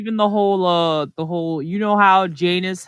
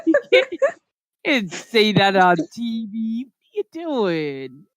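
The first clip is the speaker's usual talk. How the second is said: exasperated mock whine; 4 semitones higher than usual. Scolding annoying classmate.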